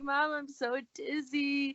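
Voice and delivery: drawn-out, mock-tearful complaint; retold crying. Daughter calling on mom while dizzy, lightly imitated crying.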